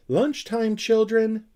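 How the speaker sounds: high, loud call across the garden. Acted. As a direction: call the children in for lunch. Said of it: "she cried".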